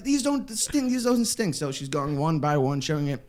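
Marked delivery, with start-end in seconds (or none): 0.0-1.5 reassuring guide. Retold or imitated. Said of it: "She's like".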